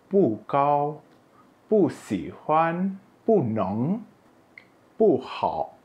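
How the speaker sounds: each pu starts high and falls; fourth-tone pu. Shown as a demonstration. Teacher reading examples, every pu in falling fourth tone. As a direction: drop pu sharply before each following word. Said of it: "ini semua pu dengan nada empat".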